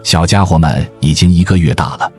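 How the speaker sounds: normal talking voice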